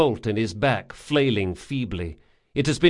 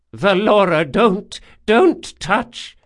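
high, loud alarmed cry; urgent warning; 8 semitones higher, 7 dB louder, slower than usual. A narrator's reading. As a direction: stop him before he touches her. Urgent warning not to touch.

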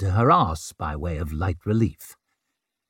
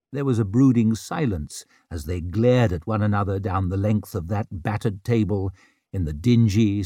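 usual speaking voice

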